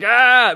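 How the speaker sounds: loud, high "Ah" of recognition; mock recognition gasp. Guest imitating someone spotting the actor, loud high ah. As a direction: shout the sudden recognition.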